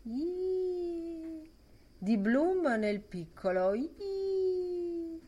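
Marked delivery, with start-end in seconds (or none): 0.1-1.5 high piping whistle sound; thin high whistling squeak. A narrator's reading. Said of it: "zufolò". 3.8-5.2 high piping whistle sound; thin high whistling squeak. A narrator's reading. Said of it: "zufolò".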